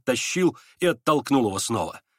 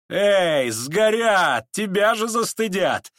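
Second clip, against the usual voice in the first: loud, high-pitched call; shouting at him; 8 semitones higher, 4 dB louder, much slower than usual. A narrator's reading. Настасья Филипповна shouts at him as the packet burns, shouting. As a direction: yell it across the room, drawn out at the end. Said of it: "кричала ему Настасья Филипповна".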